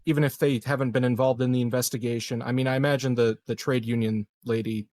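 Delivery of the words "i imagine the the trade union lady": normal talking voice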